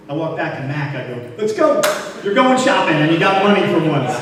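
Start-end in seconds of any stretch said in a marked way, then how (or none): 1.4-4.2 excited. Retold or imitated. Excited call to assistant.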